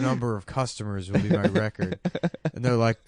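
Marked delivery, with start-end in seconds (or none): none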